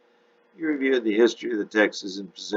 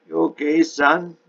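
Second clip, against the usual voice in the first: put-on higher, louder Texas accent; heavy Texas accent; 9 semitones higher, 6 dB louder than usual. A narrator's reading. Man across the library table asking a stranger, heavy Texas accent. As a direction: ask in a heavy Texas twang. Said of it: "asked in a heavy Texas accent".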